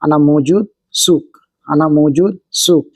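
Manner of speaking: slowly spelled out Arabic phrase; slow clear demo. Shown as a demonstration. Teacher to viewers, slow careful Arabic demo. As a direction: say each word slowly for learners. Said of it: "इसको बोलेंगे अना".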